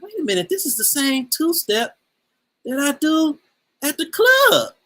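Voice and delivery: high-pitched disbelief building to a loud peak; retold excited realization. Recognizing the two-step in Ghana, with rising excitement.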